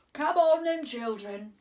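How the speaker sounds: put-on loud frog calling the children; loud, croaking call; croaky frog call. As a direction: croak it out to the tadpoles. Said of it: "he croaked".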